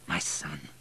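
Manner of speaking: soft, low deathbed murmur; hushed and low, to a beloved son; quietly affectionate, almost fading; tender. A narrator's reading. Dying Baron accepts boy as son.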